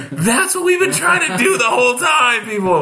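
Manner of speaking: high, laughing relief; laughing triumph. Retold or imitated. Host to a crowd finally laughing, high and gleeful. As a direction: crow it out laughing. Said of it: "And I was like".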